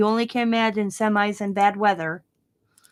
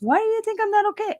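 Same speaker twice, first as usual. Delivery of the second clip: mock son, pitched way up; imitating son; 12 semitones higher than usual. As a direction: play the puzzled son, much higher. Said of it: "He goes".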